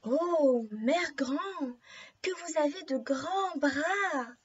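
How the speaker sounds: slow, drawn-out storybook wonder; astonished girl. Acted. Girl notices Grandmother's odd eyes, slow swooping astonishment. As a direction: marvel slowly, stretching each phrase. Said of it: "étonnée".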